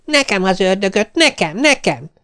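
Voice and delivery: put-on high, eager children's cries; children shouting. A narrator's reading. Hungry children clamour to eat the devil, high and eager. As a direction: squeal like greedy hungry kids. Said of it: "kiabálták".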